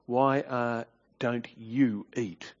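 husband quietly reproaching his downhearted wife; tone of accusation. Shown as a demonstration. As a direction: press each question with quiet blame. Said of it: "Notice the tone of accusation".

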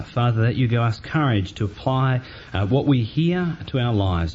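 low, hushed prayer voice. Acted. Opening prayer before sermon.

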